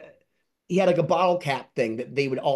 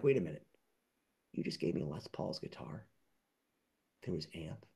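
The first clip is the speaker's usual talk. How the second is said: much softer, lower disbelieving questions; stunned hush; 11 semitones lower, 15 dB quieter than usual. Retold or imitated. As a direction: drop your voice in awe. Own stunned reaction retold.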